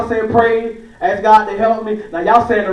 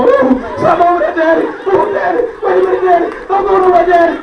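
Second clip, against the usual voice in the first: put-on scared little boy; 9 semitones higher than usual. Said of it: "You tell me".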